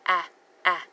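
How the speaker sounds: crisp short "Ah, ah" as in cat; short A sound. Shown as a demonstration. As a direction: say "Ah, ah" as two separate short vowels. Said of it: "There's that short A sound".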